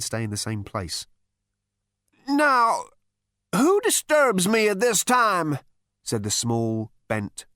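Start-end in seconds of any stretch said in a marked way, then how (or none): 2.3-5.6 put-on high, loud elderly challenge; imperious old ancestor. Ancient man challenges those who woke him, high and imperious. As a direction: demand it like an irritable ancient.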